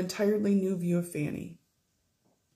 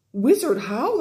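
loud, higher gasp at the name; shocked exclamation; 5 semitones higher, 6 dB louder, slower than usual. Acted. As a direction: recoil at the wizard's name.

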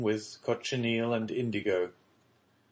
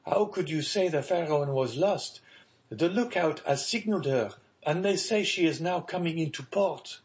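excited son bringing news, pitched up; crying out; 7 semitones higher than usual. A narrator's reading. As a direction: protest eagerly in a higher voice. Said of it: "cried Maximilian".

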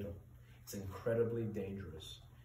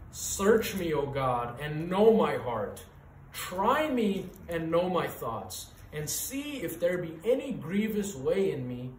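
louder, slower, pleading psalm; fervent prayer reading; 4 semitones higher, 6 dB louder, slower than usual. Acted. Preacher reading a psalm prayer to the congregation, fervent. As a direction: pray it aloud with feeling.

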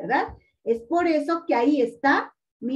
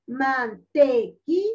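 "Mantequilla" slowly in four beats, man-te-qui-lla; syllable by syllable; slower than usual. Shown as a demonstration. Teacher hunting the unstressed syllable, "Mantequilla" slowly split. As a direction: pause between the syllables of "Mantequilla". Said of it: "la ya".

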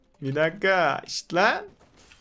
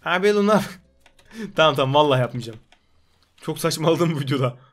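ordinary delivery

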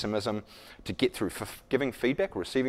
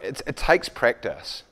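ordinary talk, nothing marked

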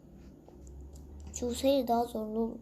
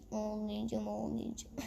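mock crying; 3 semitones lower, 10 dB quieter than usual.

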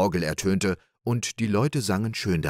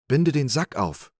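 higher and louder, urgent; eager call; 6 semitones higher, 3 dB louder than usual. Acted. Little Klaus urging the cowherd, eagerly calling. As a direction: call out quickly to be let free. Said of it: "rief der kleine Klaus".

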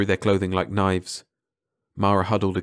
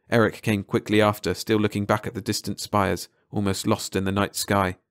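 usual speaking voice

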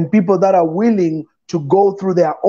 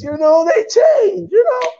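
excited cry; 13 semitones higher, slower than usual. Real, not acted. Emphatic cry about change.

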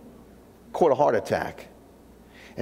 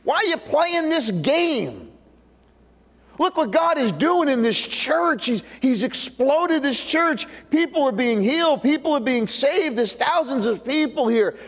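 dumbfounded; 9 semitones higher than usual. Acted. Peter confronts Ananias over his lie, dumbfounded and high-pitched. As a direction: question him in stretched-out, high-pitched astonishment. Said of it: "He's dumbfounded".

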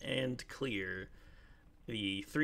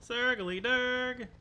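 high, slow, drawn-out nickname; high sing-song call; 5 dB louder, much slower than usual. Pretend. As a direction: stretch the name out high and playful. Calling a friend's silly nickname.

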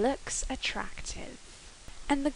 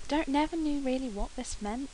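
normal talking voice

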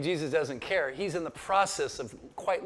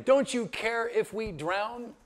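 disciples' plea; 6 semitones higher, slower than usual. Retold or imitated. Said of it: "the disciples say to him".

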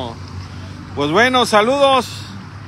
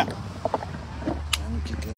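whisper; 8 semitones lower, 11 dB quieter, much slower than usual. Real, not acted. Whispered aside.

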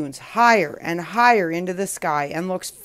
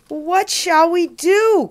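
high, drawn-out wail; distressed cry; 9 semitones higher, 5 dB louder, slower than usual. A narrator's reading. Lost boy turns to the cloud, wailing in distress. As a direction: cry out slowly, louder and higher. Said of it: "cries Oscar".

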